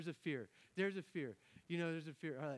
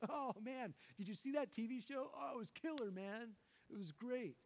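higher, gushing fan voice; mock excited chatter; 8 semitones higher than usual. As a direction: hype it up like a friend raving about a show. Mimicking people raving about shows.

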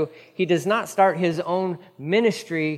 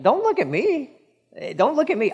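much higher, waving off the credit; don't-look-at-me, pitched way up; deflecting Peter; 10 semitones higher than usual. Acted. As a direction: Peter refusing the crowd's praise, high and insistent. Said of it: "He's like".

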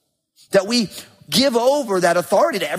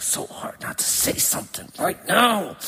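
strained, voiceless mutter through a held tongue; biting his tongue; 6 dB quieter than usual. Retold or imitated. Son on the phone resisting a fight with dad, biting his tongue. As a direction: squeeze the words out, holding back. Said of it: "bite my tongue".

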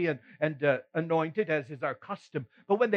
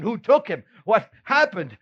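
alarmed; 5 semitones higher, 6 dB louder than usual. Acted. Peter's panicked questions at tomb.

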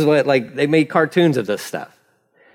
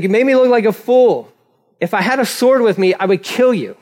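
mildly heated, higher-pitched threat; angry Balaam; 6 semitones higher than usual. Acted. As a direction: play angry, with moderate force. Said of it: "he's so angry".

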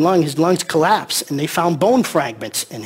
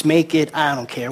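ordinary talk

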